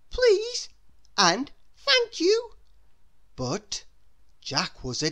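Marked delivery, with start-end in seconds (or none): 0.1-0.7 put-on high, sweet child's voice; bright squeaky good boy; little boy voice. A narrator's reading. Jack's polite words voiced. 1.8-2.5 put-on high, sweet child's voice; bright squeaky good boy; little boy voice. A narrator's reading. Jack's polite words voiced.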